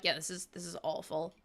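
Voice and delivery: soft, miserable groan of a line. Retold or imitated. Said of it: "And I was like".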